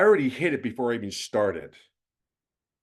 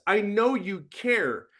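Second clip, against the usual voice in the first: ordinary delivery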